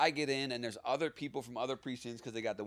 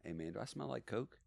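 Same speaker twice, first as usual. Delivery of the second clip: leaning in to ask; 5 semitones lower, 10 dB quieter than usual. Retold or imitated. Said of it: "he leans over to me and he goes".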